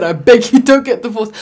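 loud, high retold plea; loud retelling. Friend repeating her blunt plea, loud. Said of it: "The way I said".

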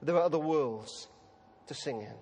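put-on soft, tender reassurance to a crying boy; gentle comfort. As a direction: comfort him softly. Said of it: "Information police said".

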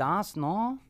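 put-on joking stab at a German accent; attempted German accent. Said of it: "Can you do a German accent".

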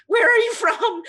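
high, loud baffled outburst; incredulous question, voice leaping up. Acted. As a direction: demand it in mock disbelief. Acted baffled reaction to fake accent.

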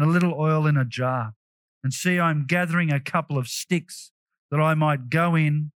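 low-pitched, subdued scripture reading; understated resigned widow. Acted. Widow telling Elijah she has no bread, understated resignation. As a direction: read it as someone with little hope left. Reads the despairing widow's reply.

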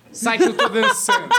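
high, slow imitation of a dancer; mock shooing. Imitating friend on the dance floor, mock shooing.

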